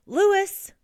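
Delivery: high, loud call of the name; shocked cry. A narrator's reading. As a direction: call out to your son in shock. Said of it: "they cried".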